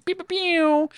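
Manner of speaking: high-pitched pew pew gunfire; gun noises. Pretend. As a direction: mimic laser blasts. Gun sound effects.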